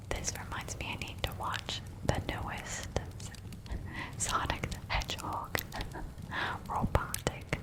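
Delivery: faint repeated whispered word; soft trigger whisper. Acted. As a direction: breathe the word softly over and over.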